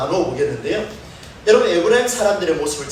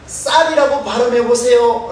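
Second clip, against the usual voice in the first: demanding guard; 5 semitones higher, 5 dB louder than usual. Acted. Soldier demanding password at river.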